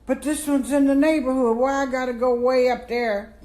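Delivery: high, fast indignant protest; mock indignant complaint. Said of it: "And I'm saying".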